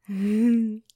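loud burst of laughter. Acted. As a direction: laugh out, surprised.

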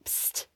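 psst. Acted. Bird calls to Gretel.

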